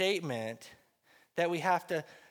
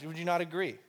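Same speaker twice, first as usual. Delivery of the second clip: ordinary talk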